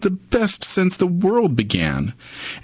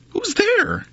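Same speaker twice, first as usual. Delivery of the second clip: high startled mouse voice; a tiny mouse calling out in alarm; squeaky mouse call; mouse crying out. A narrator's reading. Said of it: "Mouse cries".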